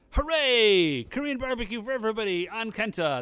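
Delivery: loud, cheering crowd of friends, a bit fast; hammed-up group hooray; mock cheer. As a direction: cheer it like the gang just got free food. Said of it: "they're like".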